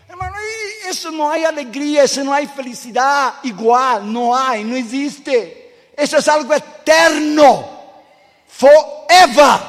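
high, loud, slow preaching shout; rising preaching. Acted. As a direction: build to an ecstatic climax. Preaching climax.

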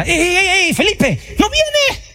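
high calling voice, drawn out. Acted. Neighbour calls to Felipe from outside, high and drawn out. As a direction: call across the camp to a neighbour.

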